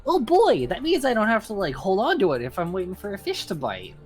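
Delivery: loud, high, delighted; excited. Acted. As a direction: burst out with eager glee. Excited at the immovable rod.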